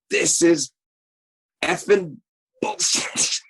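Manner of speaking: high-pitched mock yell, no real volume; retold angry yell. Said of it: "she was yelling at me".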